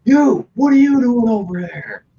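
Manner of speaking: high, slow, suspicious challenge; confronting challenge. Retold or imitated. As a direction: call out a stranger, drawn out. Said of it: "he's like".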